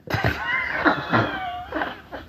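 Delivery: high laugh. Real, not acted. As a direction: laugh out at the memory. Laughing at the memory.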